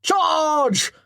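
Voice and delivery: high loud battle cry; shouting. Acted. Chatton playing a charging Viking, shouting loudly. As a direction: roar it as you rush in. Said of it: "Baring his teeth and shouting".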